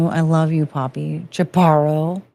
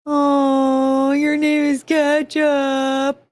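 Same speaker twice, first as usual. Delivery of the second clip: "Ketchup" cooed higher and slow; your name is Ketchup sung out to a puppy, higher and slower; 5 semitones higher, much slower than usual. Real, not acted. Coos dog's name after a laugh.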